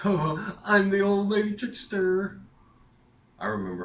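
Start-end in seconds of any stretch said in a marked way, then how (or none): 0.0-2.4 put-on very high, loud, slow old crone voice; a shrill, slow witchy old lady voice; cackling old lady. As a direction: play it like a gleeful old trickster. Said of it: "And she's like".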